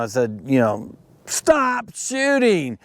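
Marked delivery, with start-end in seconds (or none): 1.3-2.8 high, drawn-out yell to stop; yelling at the shooters to stop, slow and high; retold yell. Dad yelling at careless shooters to stop, high yell. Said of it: "and I yelled at them".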